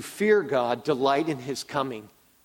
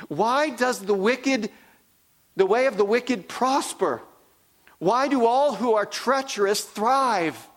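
Jeremiah's complaint; 8 semitones higher than usual. Retold or imitated. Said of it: "He begins".

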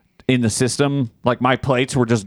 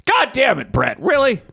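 high-pitched exasperated outburst; comically fed-up, pitch shooting up; 11 semitones higher than usual. Real, not acted. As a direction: scold him in incredulous exasperation. Scolds Brett for clueless question.

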